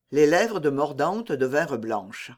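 ordinary delivery, nothing marked

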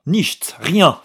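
sharp, high, loud refusal; violent retort. Acted. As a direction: snap the word away. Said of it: "fit l'autre violemment".

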